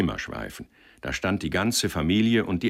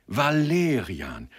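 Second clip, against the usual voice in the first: high, drawn-out cheer; jubilant cry; 7 semitones higher, 3 dB louder, much slower than usual. A narrator's reading. Leader rejoicing as he embraces Sender, exultant. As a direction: cheer the name slowly, pitched up. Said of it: "jauchzte der Vorsteher".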